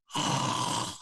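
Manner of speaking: snore through an open mouth; mouth snoring. Shown as a demonstration. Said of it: "it's gonna go like this".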